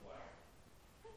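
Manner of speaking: ordinary delivery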